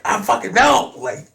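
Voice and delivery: high, loud excited reply; bursting with joy; imitating his own excited answer; juiced. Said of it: "shaking my hands like".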